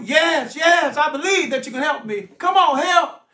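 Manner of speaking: loud, high cry for rescue; desperate pleading. Acted. Hanging man answering his would-be rescuer, urgent loud pleading.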